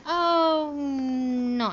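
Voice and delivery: high, loud retold groan of dismay; imitating her own exasperated "oh, no" at them; mock dismayed oh no. Retelling her reply to the officials, in a high loud mock groan. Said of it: "And I just went".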